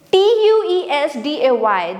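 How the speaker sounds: letter by letter spelling; spelled out. Shown as a demonstration. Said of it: "Next is".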